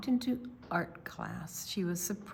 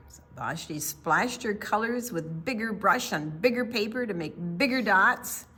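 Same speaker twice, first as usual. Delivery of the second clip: plain reading voice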